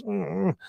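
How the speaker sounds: soft, high imitated whimper; small whimpering "mm". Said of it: "he just whimpers again".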